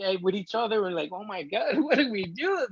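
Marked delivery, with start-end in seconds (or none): 1.1-2.7 retold crying. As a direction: re-enact crying together, helplessly. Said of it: "we're crying".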